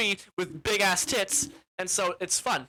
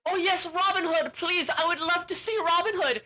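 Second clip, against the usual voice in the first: much higher, louder mock-feminine voice; gushing wife; 11 semitones higher, 4 dB louder than usual. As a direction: gush eagerly in a high put-on voice. Retold wife's gushing plea.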